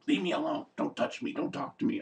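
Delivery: mimicking Carl, softly sulky. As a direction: mumble it like a brooding kid. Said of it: "He's just like".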